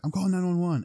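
high, loud retold alarm; mock panicked announcement. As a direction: blurt it out like someone panicking. Acting out panicked threat to call police.

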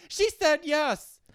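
high, loud, drawn-out holler; shouted announcement. Retold or imitated. Heckler interrupting a comic with a proposal, high mock shout. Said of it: "all I hear in the back is".

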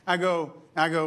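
ordinary delivery

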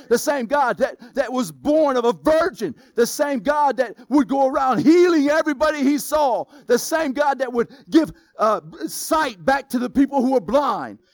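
rising from strong to a full shout; building preaching. Real, not acted. Pastor shouting to his church, building to a roar. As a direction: build the list of miracles to a shouted climax.

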